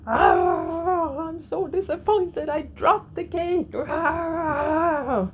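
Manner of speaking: high-pitched mock scream of disappointment; demo scream. Shown as a demonstration. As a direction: wail it out high like a demo of venting. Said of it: "may be to scream out loud".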